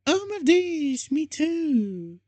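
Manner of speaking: very high, slowed, half-breathy squeal; shrieked. A narrator's reading. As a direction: squeal it way up high in fangirl delight. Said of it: "I shrieked".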